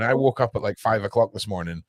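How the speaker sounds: usual speaking voice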